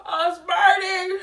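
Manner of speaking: sobbing from burning lips; crying. Real, not acted. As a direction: cry it out in real pain. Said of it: "I'm literally in tears".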